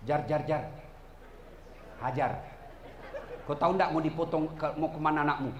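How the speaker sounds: low, soft tempting devil voice, fast at the end; sly devil. Acted. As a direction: coax her quietly like a devil. Setan berbisik menggoda Hajar. (suara setan pelan menggoda)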